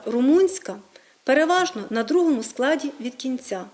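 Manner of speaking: normal talking voice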